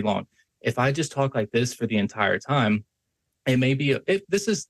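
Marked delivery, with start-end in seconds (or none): 0.6-2.8 one unvarying pitch all the way through; monotone. Shown as a demonstration. Coach shows being stuck in one pattern, monotone. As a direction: keep every word on the same note.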